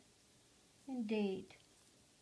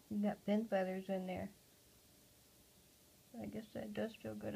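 normal talking voice

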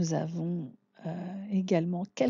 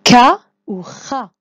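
Ka ou Ra called loud and slow; prompting the class; 4 semitones higher, 15 dB louder, slower than usual. Shown as a demonstration. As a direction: call out Ka ou Ra to the class. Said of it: "À vous".

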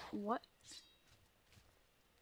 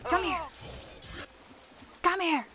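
loud, higher shouts at the enemy; calling out; 6 semitones higher, 3 dB louder than usual. Real, not acted. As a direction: yell at the foe to come closer. Chasing an enemy in combat.

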